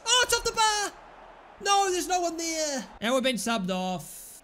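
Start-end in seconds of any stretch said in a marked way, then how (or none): 0.1-2.9 high excited yell; excited shout. Real, not acted. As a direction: shout at the chance. Near goal, shouting.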